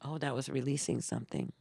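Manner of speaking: normal talking voice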